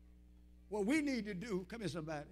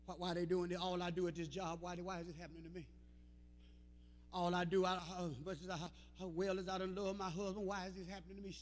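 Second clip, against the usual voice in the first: mock whining; 6 semitones lower, 9 dB quieter, faster than usual. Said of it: "we start whining".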